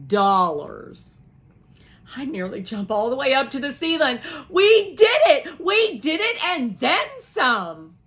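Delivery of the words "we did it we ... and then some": much higher and louder, slow; jubilant cheer. Acted. Piper celebrating the total, high and loud. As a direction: shout for joy.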